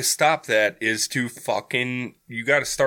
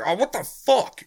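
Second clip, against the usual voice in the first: angry outburst; 9 semitones higher than usual. Retold or imitated. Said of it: "he'll be like".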